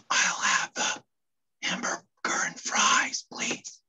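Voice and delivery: whispered order, no voice at all; library whisper. Acted. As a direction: whisper it like you remember you're in a library. Guy corrects himself in library.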